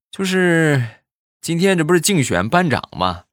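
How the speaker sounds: imitated teacher. Teacher telling the parent about the class election, put-on voice. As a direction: play the teacher explaining to the parent.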